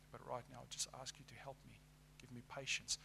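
softly spoken plea to God; hushed, humble request in prayer; acted prayer. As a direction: pray it quietly, asking for help.

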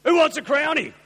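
loud and high, hailing the whole room; calling out loud. Retold or imitated. Brothel owner calling out to the funeral guests, loud. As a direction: shout it out to the room, offering beers.

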